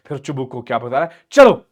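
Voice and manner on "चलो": high, very loud single burst; acted bark, cutting the quarrel off; exasperated shout. Father cutting off daughter's blame, acted shout.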